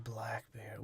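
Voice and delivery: hushed, whispery announcement; hushed aside. Acted. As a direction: whisper it as a mock-dramatic reveal. Acting out Sparrow naming Blackbeard.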